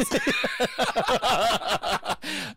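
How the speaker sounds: high burst of long laughter; hearty laugh. Real, not acted. Host cracks up at friend's Mets answer, hearty laugh. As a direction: crack up helplessly.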